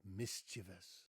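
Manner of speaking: three syllables in "mischievous", stress up front; first-syllable stress. Shown as a demonstration. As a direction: model "mischievous" slowly, stressing MIS. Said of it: "stress is on the first syllable".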